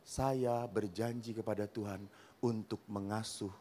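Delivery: soft, low, sinking to a whisper; calm, hushed. Retold or imitated. Wife quietly revealing her vow to her husband, hushed. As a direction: deliver the punchline quietly and coolly. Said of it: "istrinya dengan tenang ngomong".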